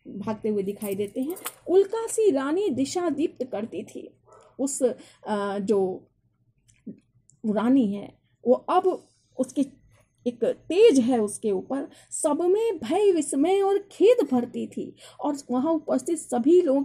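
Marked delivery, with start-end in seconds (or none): 1.7-4.2 high, declaimed verse line; poem recitation. Acted. Teacher reciting the queen's description to students, declaimed. 12.1-14.9 slow, high declaimed verse; poem recitation. Acted. Teacher closing the verse for students, slowly declaimed.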